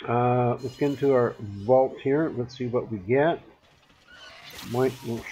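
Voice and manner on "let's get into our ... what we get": normal talking voice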